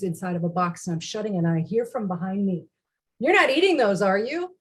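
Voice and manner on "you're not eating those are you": higher, louder questioning of a spouse; imitated challenge. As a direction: catch them out with a pointed question. Husband challenges her from behind.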